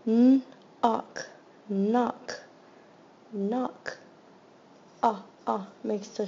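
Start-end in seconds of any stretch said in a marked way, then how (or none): 0.1-4.0 loud, segmented; sounding out knock. Shown as a demonstration. Teacher sounding out a word for students, loud and segmented. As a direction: split knock into N and ock, then blend. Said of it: "the K at the beginning is silent".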